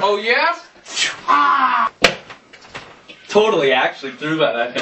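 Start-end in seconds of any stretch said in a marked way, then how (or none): 1.3-1.9 high shout on cue; fake scream. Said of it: "I just screamed on cue".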